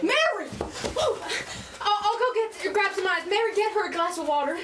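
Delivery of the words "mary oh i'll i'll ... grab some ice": sharp high call of the name; startled, calling out to her sister; alarmed cry. Acted. Daughter crying out as her mother collapses.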